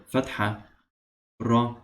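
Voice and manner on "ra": ordinary talk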